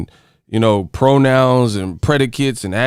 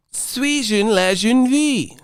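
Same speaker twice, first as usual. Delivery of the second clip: high, drawn-out mock-French child voice; little kid imitation; 7 semitones higher, slower than usual. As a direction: pitch it way up and stretch the French. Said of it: "the motherfucking kid said".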